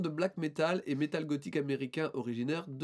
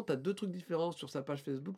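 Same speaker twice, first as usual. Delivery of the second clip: usual speaking voice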